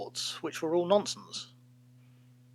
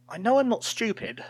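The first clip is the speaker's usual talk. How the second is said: defensive; 7 semitones higher than usual. A narrator's reading. Official's defensive thought.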